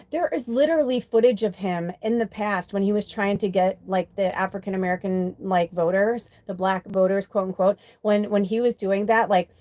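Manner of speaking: ordinary talk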